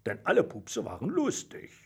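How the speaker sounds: ordinary delivery, nothing marked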